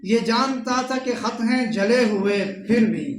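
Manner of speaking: poetry recitation. Acted. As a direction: recite the couplet to the gathering, line by line. Recites couplets at a mushaira. (मुशायरे के अंदाज़ में शेर पढ़ना)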